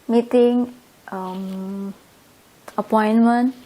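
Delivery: usual speaking voice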